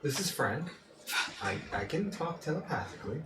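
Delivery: soft, halting otherworldly voice; psychic ethereal voice. Acted. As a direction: whisper it into their minds. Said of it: "psychic ethereal voice".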